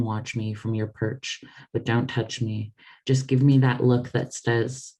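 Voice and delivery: measured, intimate reading of her own letters; literary reading. Acted. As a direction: read it like an open letter to close friends. Author reads her book aloud.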